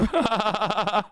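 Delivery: short run of steady laughter; amused chuckling in a quick string. Real, not acted. Host amused by a slipped double standard, laughing.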